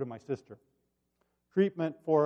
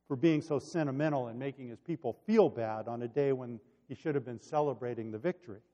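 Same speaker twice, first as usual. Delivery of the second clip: normal talking voice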